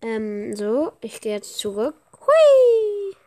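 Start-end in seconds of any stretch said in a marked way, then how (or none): 2.2-3.1 much higher, louder squeal of glee; gleeful whee. Real, not acted. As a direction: let it fly up like a ride. Joyful exclamation returning to lobby.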